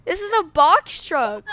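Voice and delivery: high, slow, loud protest; incredulous high-pitched exclamation; loud disbelief. Real, not acted. Disbelief at smaller storage.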